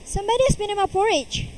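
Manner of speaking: fast, high mother-bear complaint; a lightly voiced middle bear. A narrator's reading. Mother bear finds her porridge touched, lightly voiced. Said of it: "in his middle voice".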